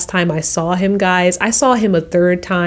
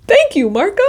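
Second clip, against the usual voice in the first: relieved high thanks; 13 semitones higher than usual. Retold or imitated.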